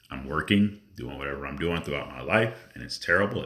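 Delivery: ordinary talk, nothing marked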